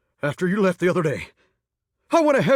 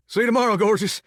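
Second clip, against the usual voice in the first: normal talking voice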